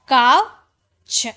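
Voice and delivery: काउच drawn out slowly and loud, rising; slow model word. Shown as a demonstration. Teacher models काउच slowly for spelling students. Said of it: "वैसे काउच".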